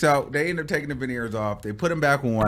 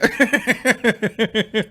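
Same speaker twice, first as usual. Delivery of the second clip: high staccato cackling; real laughter; 9 semitones higher, 4 dB louder than usual. Real, not acted. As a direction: burst out laughing at the story. Cracks up at own story.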